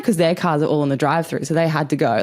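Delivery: ordinary talk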